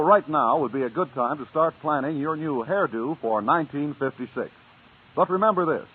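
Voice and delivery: normal talking voice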